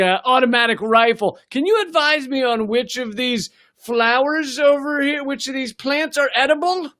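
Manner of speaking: a lightly voiced mock civilian sweetly asking a soldier about flowers; mock naive request. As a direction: play the clueless civilian, sarcastically. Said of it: "Were you like".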